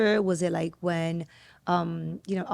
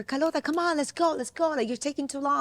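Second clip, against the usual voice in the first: higher-pitched impatient kid, retold; lightly acted, high-pitched nagging; impatient urging; 7 semitones higher than usual. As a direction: imitate a child hurrying a friend along. Child hurrying a scared friend.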